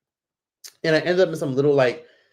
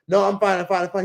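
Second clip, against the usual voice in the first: higher, quick brush-off; hurried polite refusal; 6 semitones higher than usual. Retold or imitated. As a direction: re-enact politely waving off a stranger. Acting out her refusal again.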